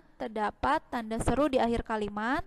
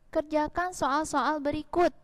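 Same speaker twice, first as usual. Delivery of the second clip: "kerjakan soal-soal berikut" said higher as a firm instruction; rising command tone; 4 semitones higher than usual. Shown as a demonstration. Teacher modelling a command for the class, pitched higher. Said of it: "intonasinya naik".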